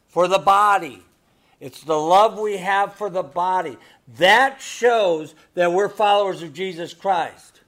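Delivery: preaching. Real, not acted. Pastor stressing love within the church, loud and firm. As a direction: press the point on the body.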